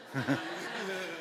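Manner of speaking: high, soft laugh. Real, not acted. Preacher laughing at his own marriage joke. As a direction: chuckle at the joke.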